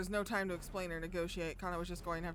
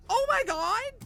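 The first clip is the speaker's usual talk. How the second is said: shocked cry; 13 semitones higher, 10 dB louder, slower than usual. Real, not acted. Streamer shocked.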